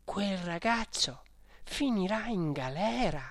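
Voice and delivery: put-on annoyed young lady grumbling; irritated grumble. A narrator's reading. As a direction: mutter it crossly under your breath. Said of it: "borbottando tutta stizzita".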